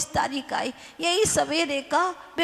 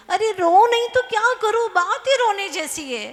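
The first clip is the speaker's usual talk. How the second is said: mock wailing; 8 semitones higher, 5 dB louder than usual. Weeping man wails his answer.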